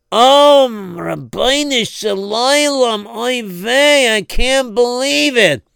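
loud gasp then slow lament; sudden horrified realization; dismayed realization. Acted.

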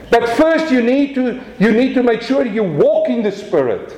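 high, fervent preaching; impassioned pulpit cry; preaching at full force. Acted. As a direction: drive it home to the congregation.

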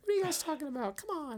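very high mock sister-in-law voice. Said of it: "She's like".

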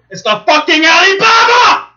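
high, loud emphatic shout; exasperated shout. Real, not acted. Telling the cohost about the ride, shouting. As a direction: hit it hard, fed up.

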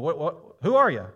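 quick, stammered disbelief; acted-out incredulous questioner; incredulous challenge. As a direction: stumble into a baffled demand. Voicing baffled challengers of Jesus.

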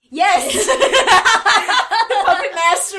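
slow, loud words through laughter; laughing. Real, not acted. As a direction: howl it while laughing. Cracking up at a favorite episode.

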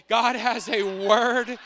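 loud, slow preaching; emphatic proclamation. Real, not acted. Preacher promising the congregation a word from God, loud proclamation. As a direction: proclaim it big and measured.

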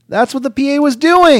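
squeaky high pitched voice; high mock voice. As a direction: jump way up in pitch. High mocking voice.

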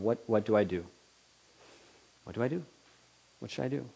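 a penitent quietly asking what to do; quiet helpless asking. Acted. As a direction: ask it humbly, fading. Repentant people's helpless question.

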